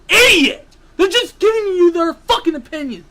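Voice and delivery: loud, high-pitched burst of yelling; angry yell. Real, not acted. Host losing it at fans who attack writers, angry yell. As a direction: explode at them, yelling the insult. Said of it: "I said I wasn't gonna yell".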